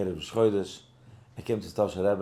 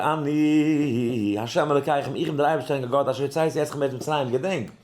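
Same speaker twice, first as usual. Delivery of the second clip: loud, raised scriptural proclamation; declaimed verse; 5 semitones higher, 5 dB louder than usual. Acted. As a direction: proclaim the verse at full force.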